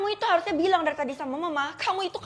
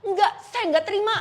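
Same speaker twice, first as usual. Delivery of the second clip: defiant refusal; 6 semitones higher, 5 dB louder than usual. Acted. Refuses death sentence.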